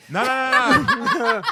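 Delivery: high laughing no; laughing protest. Real, not acted. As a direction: burst out laughing in refusal. Refusing taste test, laughing.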